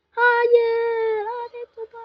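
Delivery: high loud cry of no; real dismay; anguished shout. Real, not acted. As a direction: yell it as the plan fails. Enemy plays the cannon.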